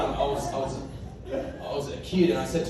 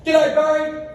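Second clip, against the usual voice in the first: mock friendly greeting; 10 semitones higher, 5 dB louder than usual. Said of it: "I just pretend I know them".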